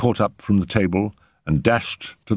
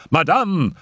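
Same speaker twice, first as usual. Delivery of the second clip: high, urgent call; alarmed cry; 8 semitones higher than usual. A narrator's reading. Raoul stops the mother rising, alarmed cry. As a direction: call out in alarm, pitch jumping up. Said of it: "cried Raoul, alarmed".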